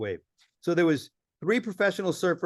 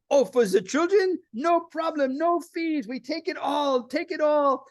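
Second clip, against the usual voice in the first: much higher, gushing clerk who melts at once; suddenly sweet clerk; 10 semitones higher than usual. Retold or imitated. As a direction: beam it out like she's won over. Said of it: "And she goes".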